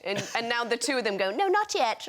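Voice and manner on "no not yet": high, playful kids' mimicry; children impersonating mum. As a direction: mimic children parodying their mother, pitched right up. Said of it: "they quite like impersonating me".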